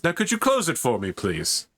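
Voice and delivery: armored warrior making a courteous request mid-attack; Space Marine. Acted. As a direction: start high and brisk, fall away on please. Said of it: "tosses it in and says".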